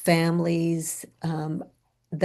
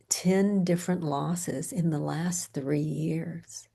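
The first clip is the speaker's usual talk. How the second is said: ordinary talk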